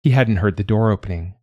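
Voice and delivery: ordinary delivery, nothing marked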